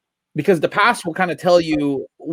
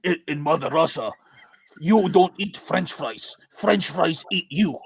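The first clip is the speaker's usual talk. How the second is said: put-on Russian accent; 4 dB quieter than usual. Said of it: "Russian accent".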